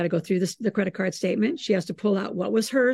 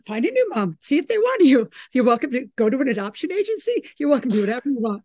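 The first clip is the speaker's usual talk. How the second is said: mock sassy retort; 5 semitones higher, 3 dB louder than usual. Mom's retort voiced with bravado.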